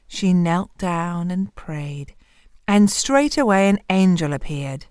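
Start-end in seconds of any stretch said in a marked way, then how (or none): none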